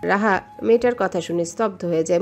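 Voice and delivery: plain reading voice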